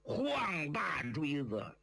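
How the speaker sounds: usual speaking voice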